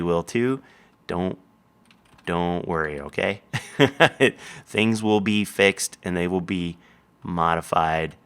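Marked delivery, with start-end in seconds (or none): 3.8-4.3 light laugh after the words. Real, not acted. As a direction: chuckle it out. Laughing at own joke.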